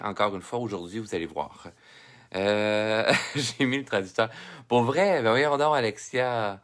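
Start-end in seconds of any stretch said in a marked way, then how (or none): 4.7-5.8 amused disbelief. Real, not acted. Laughing at the bad translator.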